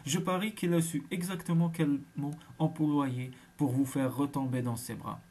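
plain reading voice

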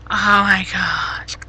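slow, breathless whisper of disbelief; shocked whisper. Real, not acted. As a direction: whisper it slowly, stunned. Reacting to shocking chat message.

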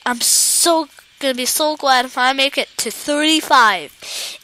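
ordinary delivery

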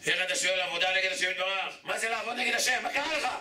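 incredulous, escalating reproach; indignant scolding. Acted.

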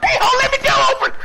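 loud excited yell; shouting. Real, not acted. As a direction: shout it out over the game. Yelling at teammate mid-fight.

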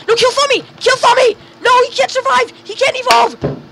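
high-pitched panicked gamer cries; desperate high yelps; frantic urging. Real, not acted. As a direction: urge the team on, voice way up. Frantic calls during game fight.

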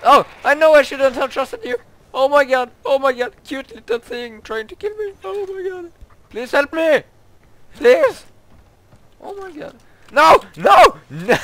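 high, loud panicked pleas; panicked shrieking. Real, not acted. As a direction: beg for help in panic. Attacked by creature, panicking.

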